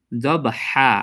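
slow দবহা ending on a long open হা, no n; pausal ending. Shown as a demonstration. Teacher showing the reading when breath is released, pausal ending. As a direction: drop the n of দবহান and draw out হা. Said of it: "তখন কি হয়ে যাবে?".

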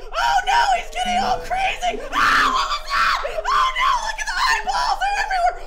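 high, loud, frantic screaming; real, high-pitched panic; panicked shouting. Real, not acted. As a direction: shriek in alarm at a jump scare. Panicking at game scare.

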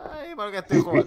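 high loud despairing cry; terrified wail. Real, not acted. Scared player regretting playing, high loud wail. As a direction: wail at your own choice.

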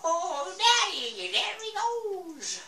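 put-on high, slow excited old man. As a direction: squeal it like a wild old man. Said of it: "my old man Jenkins voice".

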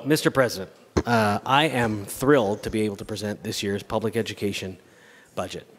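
ordinary delivery

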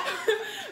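high bright laugh; spontaneous laugh; burst of laughter. Real, not acted. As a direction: laugh out at the joke. Laughs at friend's reaction.

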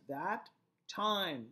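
that TIME, with time stretched and falling; stress on time. Shown as a demonstration. Teacher marking the first iamb, stress on time. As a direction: lean hard on time, that kept light. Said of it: "the emphasis here is on time".

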